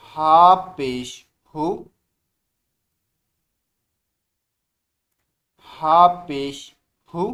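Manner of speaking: slow, drawn-out letter drill; slow recitation. Shown as a demonstration. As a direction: spell it out slowly for beginners. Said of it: "बुलंद आवाज़ से".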